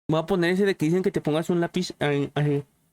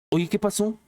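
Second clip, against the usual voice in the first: usual speaking voice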